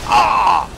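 loud, short cry of alarm; startled shout. Real, not acted. Player attacked in the game, startled shout. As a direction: shout out as if jumped.

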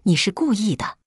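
mildly trembling accusation. A narrator's reading. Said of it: "声音哆嗦道". (声音微微发抖)

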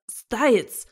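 loud, aghast exclamation; sharp outcry, falling at the end; horrified cry. A narrator's reading. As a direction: call the name out in shock. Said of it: "rief dieser entsetzt aus".

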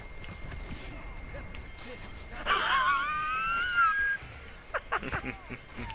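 shrieking laugh. Real, not acted. Laughs at chasing teammate.

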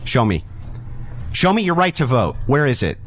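usual speaking voice

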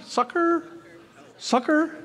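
"Succor" high, like "sucker"; questioning pronunciation. Shown as a demonstration. Preacher checking with listeners, high questioning "sucker" for "Succor". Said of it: "how do you say it?".

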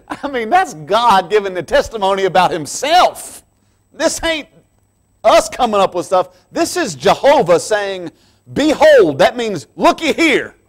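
fervent high-pitched sermon peak; preaching at full force. Acted. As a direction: proclaim it like thunder from the pulpit. Preaching climax, rising.

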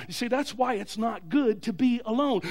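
ordinary talk

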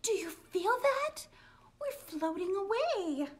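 high, urgent frightened voice; scared girl on a floating house; alarmed whisper. Acted. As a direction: gasp it out in fright.

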